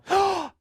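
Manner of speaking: sharp high gasp of triumph; excited gasp. Retold or imitated. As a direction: gasp like it finally worked. Mom succeeds with the code.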